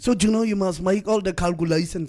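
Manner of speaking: mock Damara-accented English. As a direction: do an engineer with a thick Damara accent. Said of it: "it's just the Tamara accent in him".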